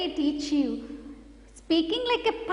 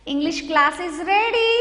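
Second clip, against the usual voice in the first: ordinary delivery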